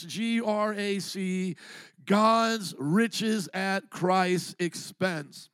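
slow, letter by letter G R A C; spelled out slowly. Shown as a demonstration. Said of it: "here's a simple version".

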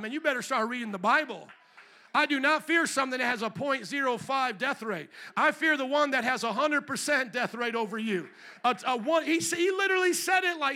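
loud, high fiery preaching, pressing the warning home; preaching at full force. Acted. Preacher warning the congregation to fear God, loud and fiery. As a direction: hammer the fear of God into them.

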